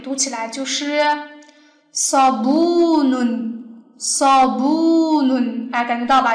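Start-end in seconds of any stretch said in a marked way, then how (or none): 1.9-5.7 sabunun very slow, BU held long and pushed; teacher's stretched model of sabunun, weight on bu; stress on BU. Shown as a demonstration. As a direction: lean hard into bu in sabunun. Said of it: "这是很重的".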